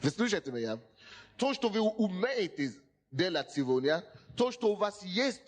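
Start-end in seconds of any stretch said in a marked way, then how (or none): none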